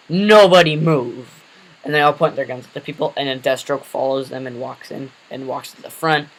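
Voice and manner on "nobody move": slow, loud barked order; armed thug command. Acted. Hydra thug to the crowd, a slow loud command. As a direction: hold the room at gunpoint.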